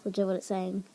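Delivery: usual speaking voice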